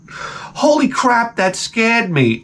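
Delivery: slow, high-pitched fake fright; hammed-up startled show host; mock scare. Mimicking host's scare catchphrase.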